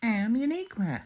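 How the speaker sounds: high-pitched, drawn-out character introduction; chirpy character voice. Acted. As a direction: pitch it way up to greet everyone. Character repeats her name as answer.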